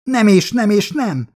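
loud, high, emphatic refusal; firm refusal. A narrator's reading. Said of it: "mondta határozottan".

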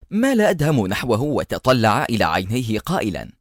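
usual speaking voice

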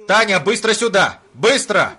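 loud, urgent calling out; urgent shout. Acted. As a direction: shout for help in a panic. Calling secretary in panic.

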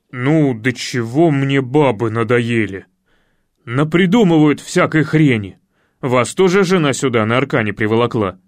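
loud, grumbling man, mostly low; ворчащий Илья. Acted. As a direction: grumble loudly about the womenfolk. Илья ворчит на женщин. (громко ворчать низким голосом)

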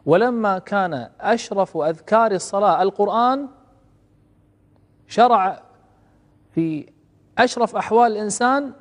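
loud, slow, drawn-out proclamation; declaiming preacher. Acted. Preacher reading Ibn al-Qayyim to the congregation, slow loud declamation. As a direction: lift the quote up and hold it.

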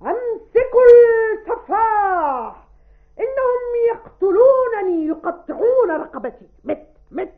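high, loud melodramatic stage screams; violent, clear delivery. Shown as a demonstration. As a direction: scream it out as a murder victim on stage. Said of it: "بعنف شديد وأداء واضح".

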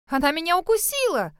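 high-pitched, outraged cry; stunned, indignant squeal; shocked exclamation. A narrator's reading. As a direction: exclaim in shock at being bitten. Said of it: "потрясённо воскликнула Шерри".

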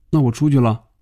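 ordinary delivery

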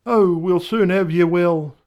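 high, loud cheery doctor. Acted. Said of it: "he says".

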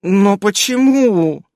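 whimpering moan. A narrator's reading. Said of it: "С подвывающими нотками в голосе негромко простонала".

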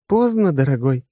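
ordinary delivery

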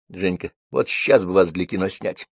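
usual speaking voice

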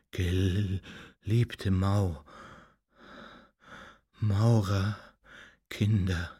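faint, halting, drawn-out words with long gaps; barely audible slurring. A narrator's reading. Supposedly dead master addresses his followers, faintly slurring. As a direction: slur it weakly like someone waking from death. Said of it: "kaum hörbar zu lallen".

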